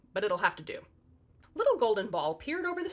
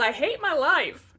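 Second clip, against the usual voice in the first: slow, louder hammed-up wail; mock wail; 5 semitones higher, 7 dB louder than usual. A narrator's reading. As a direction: drag it out in fake despair. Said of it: "and Ivan wailed".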